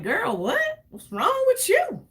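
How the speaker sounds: shocked friend who can't believe it; incredulous girl what. Retold or imitated. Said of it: "I'm like".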